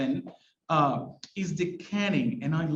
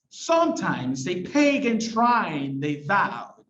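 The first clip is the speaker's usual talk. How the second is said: slow reading with "Sometimes", "pagan", "shrine" and "vowed" each hit hard; dramatic poet's recital, slowly stressing "Sometimes", "pagan", "shrine", "vowed"; exaggerated stresses; 3 dB louder, slower than usual. Shown as a demonstration. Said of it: "I'm exaggerating a little bit when I read this".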